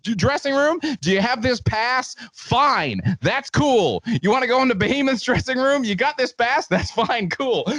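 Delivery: high, emphatic parody of official backstage rules; mock rule recital. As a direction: list the rules like a strict bouncer, with mock gravity.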